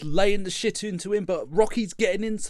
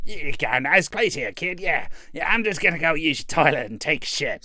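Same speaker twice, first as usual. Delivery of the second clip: Mick impression. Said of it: "being all like".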